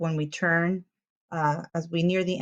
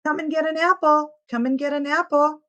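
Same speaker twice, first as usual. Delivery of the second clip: put-on higher, louder call to the children; the farmer calling kids over for apples, raised voice; 9 semitones higher, 5 dB louder than usual. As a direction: invite the whole schoolyard loudly. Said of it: "calls Mister Bumbleticker".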